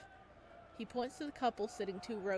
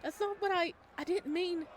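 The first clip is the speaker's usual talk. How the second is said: higher, louder stumbling denial; flustered protest; 9 semitones higher, 6 dB louder than usual. Acted. Xander, caught off guard by Luca's offer, protests flustered and higher. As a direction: scramble to take it back.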